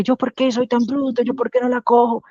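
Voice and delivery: high, exasperated self-scolding; mock despair at your own slowness; self-reproach. A frustrated learner scolding themselves, high and exasperated. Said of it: "se desespera la gente pensando que".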